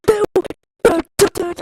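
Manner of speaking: high, loud panicked yell; retold shout. Reliving panic in the bathroom, a loud yell. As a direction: cry out in panic at yourself. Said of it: "I was like".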